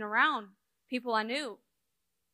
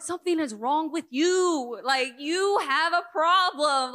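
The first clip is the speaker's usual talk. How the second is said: louder, higher, slowed blunt rebuke; retold teenage scolding, pushed loud; blunt telling-off; 5 semitones higher, 7 dB louder than usual. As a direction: tell her straight she has a problem. Telling someone off at fifteen.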